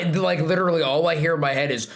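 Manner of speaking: ordinary talk, nothing marked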